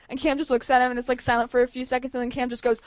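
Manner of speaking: ordinary talk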